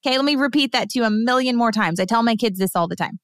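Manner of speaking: usual speaking voice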